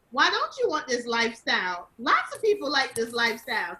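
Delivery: ordinary talk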